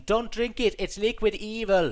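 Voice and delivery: high, loud, slow mock yell; yelling warning. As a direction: scream a warning at the screen.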